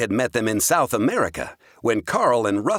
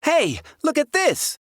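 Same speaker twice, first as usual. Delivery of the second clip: put-on high, excited boy's call; excited shout. A narrator's reading. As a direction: call out in sudden discovery. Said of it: "he suddenly shouted".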